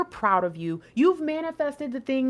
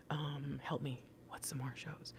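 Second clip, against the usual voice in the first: breathy whisper to herself, much softer; whispered aside; 10 semitones lower, 19 dB quieter than usual. Real, not acted. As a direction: mutter it under your breath, searching for ideas. Asks herself for show names.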